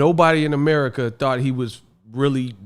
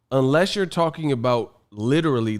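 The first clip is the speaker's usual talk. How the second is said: normal talking voice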